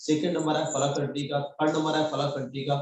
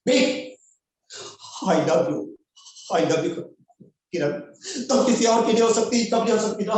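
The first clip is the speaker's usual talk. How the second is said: loud, high filmy lover's plea; Darr dialogue; 7 semitones higher, 5 dB louder than usual. Acted. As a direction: belt out the obsessed lover's dialogue. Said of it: "dialogues मेरा चालू है डर का". (शाहरुख़ खान के डर वाले अंदाज़ में ऊँची, ज़ोरदार पुकार)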